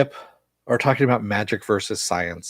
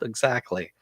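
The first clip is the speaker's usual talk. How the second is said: ordinary delivery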